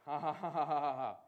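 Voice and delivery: staged laugh at a bad report; deliberate laugh. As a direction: laugh it off on purpose, ha-ha-ha. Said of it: "When you're laughing".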